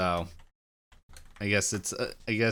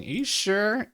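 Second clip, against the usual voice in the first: doubtful, pitched well up; high unsure voice; 12 semitones higher, slower than usual. Retold or imitated. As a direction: ask it nervously and high. Zeus quote in retelling.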